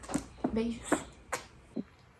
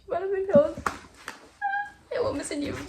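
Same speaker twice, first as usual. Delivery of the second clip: delighted; 9 semitones higher than usual. Real, not acted. Encantada com o livro.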